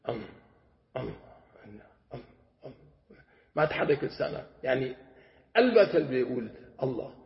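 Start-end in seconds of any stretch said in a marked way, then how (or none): 0.1-2.7 barely audible closed-mouth hum, tongue still; humming dhikr. Shown as a demonstration. Sheikh demonstrating silent dhikr to students, faint hum. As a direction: hum "أم" softly without moving the tongue, as if in the heart.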